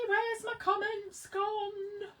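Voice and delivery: high, slow and drawn out; high drawn-out complaint; whiny plea. Real, not acted. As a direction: plead to Eddie in a sing-song. Lost comments, calls to Eddie.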